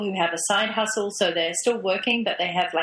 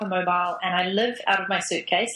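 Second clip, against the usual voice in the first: ordinary talk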